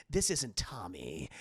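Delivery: low, slow killer imitation; menacing caller. Stranger revealing he isn't Tommy, menacing. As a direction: correct her slowly, deep and ominous.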